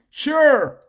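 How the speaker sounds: high, loud, drawn-out yes; eager assistant. Retold or imitated. ChatGPT agreeing to create a goal setting, eager assistant. Voices ChatGPT's instant willing reply.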